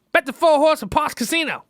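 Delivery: a hint of an old lady pushing her bet; grandmother's voice.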